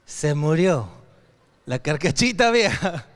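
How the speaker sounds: low-pitched, mock-solemn punchline; mock-solemn announcement. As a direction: drop low to pronounce the car dead. Announces his old car's death.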